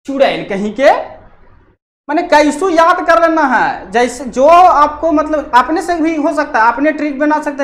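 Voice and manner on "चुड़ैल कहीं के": angry scolding. Acted. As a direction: snap at someone like a scolding mother. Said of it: "तो बोली".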